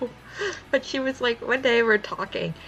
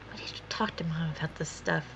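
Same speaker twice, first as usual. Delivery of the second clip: hushed and softer, serious; soft whisper; 3 semitones lower, 8 dB quieter than usual. Retold or imitated. Her sister quietly raising a concern about their mom, hushed. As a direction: lean in and whisper it.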